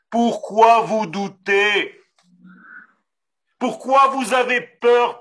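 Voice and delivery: ordinary talk, nothing marked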